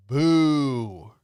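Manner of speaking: drawn-out loud boo; loud mock boo. Heckler booing the magician, loud and drawn out. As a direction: boo him off the stage.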